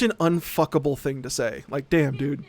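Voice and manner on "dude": ordinary delivery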